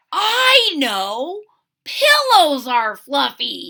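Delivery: loud, slow bright realization; sudden idea. Acted. Fluffy thinking of pillows, loudly excited. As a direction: burst out with the new idea.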